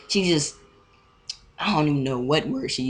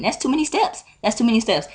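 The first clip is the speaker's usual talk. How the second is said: high-pitched whiny objection; exasperated protest; 7 semitones higher than usual. Retold or imitated. Student objecting to all that moving, exasperated. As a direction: voice shooting up in disbelief. Rant about swapping seats.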